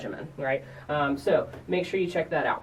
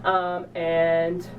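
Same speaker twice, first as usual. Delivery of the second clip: ordinary talk, nothing marked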